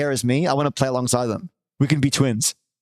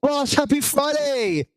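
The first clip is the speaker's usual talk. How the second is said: puppet voice; 10 semitones higher, slower than usual. Pretend. Greeting the puppet sidekick.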